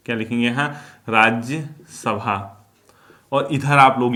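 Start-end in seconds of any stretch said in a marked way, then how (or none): 1.1-2.6 "Rajya Sabha" stretched out very slowly; slow dictation. Shown as a demonstration. Teacher telling students what to write, slow dictation. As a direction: draw out "Rajya Sabha" so it can be written down. Repeats term slowly for note-takers.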